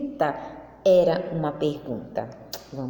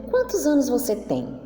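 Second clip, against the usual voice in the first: "Quantos anos você tem?" lifted higher as a clear question; question intonation; 6 semitones higher than usual. Shown as a demonstration. Teacher shows the children how a question sounds, lifted higher. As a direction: ask it so the kids hear the question tune. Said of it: "Se eu pergunto".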